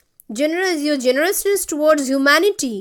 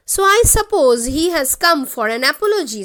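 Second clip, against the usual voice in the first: ordinary talk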